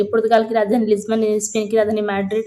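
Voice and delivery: brisk, run-together recital for daily revision; quick revision reading. Shown as a demonstration. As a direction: rattle it off fast. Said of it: "जल्दी जल्दी से ऐसे". (जल्दी-जल्दी पढ़ते हुए)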